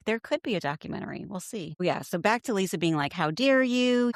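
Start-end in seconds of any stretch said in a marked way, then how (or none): 3.1-4.1 mock haughty. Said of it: "Her whole demeanor".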